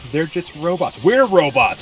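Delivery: high, rising to loud on We're robots; incredulous, building to a shout; rising indignant cry; exasperated. Real, not acted. First take of the outburst.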